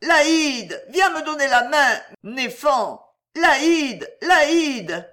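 high, loud plaintive calls; wailing, insistent cry for a friend. A narrator's reading. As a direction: shout up the stairs for a helping hand. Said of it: "et elle criait".

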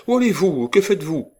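high, loud scandalised questions; indignant outcry. A narrator's reading. Old lady demanding where the girl is going, indignant outcry. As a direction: fire off alarmed questions at the top of your range. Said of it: "s'écria-t-elle".

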